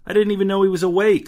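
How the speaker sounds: blurted out in surprise; startled. Acted. Caught out by the baby.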